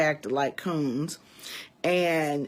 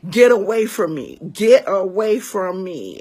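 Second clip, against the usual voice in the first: loud, raised warning, short of screaming; held-back retold scream; 3 semitones higher, 8 dB louder than usual. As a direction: re-enact yelling him off without full volume. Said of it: "not even gonna scream like I was screaming".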